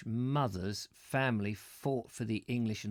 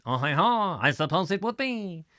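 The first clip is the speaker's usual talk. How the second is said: loud, higher hammed-up French accent; mock French accent; 6 semitones higher, 6 dB louder than usual. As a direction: burst into a jokey French accent. Mock-French reply to "looks like old France.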